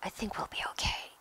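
quick, soft whisper. A narrator's reading. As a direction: whisper it hurriedly so nobody hears. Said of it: "Harry whispered".

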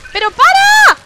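very high, loud desperate shriek; frantic high scream; screaming stop. Real, not acted. Begging attacker to stop.

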